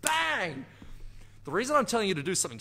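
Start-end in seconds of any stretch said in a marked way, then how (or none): 0.0-0.7 a sudden high, punchy shout; all-in self high five. Pretend. Coach demonstrating a self high five with a gleeful bang. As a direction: slam it out like a triumphant smack. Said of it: "especially if you go for it like".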